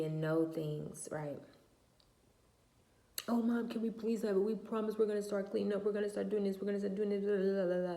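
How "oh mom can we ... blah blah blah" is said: put-on high pleading child voice, words rushing; mock pleading children; whiny kids begging. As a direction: beg like a kid promising to be good.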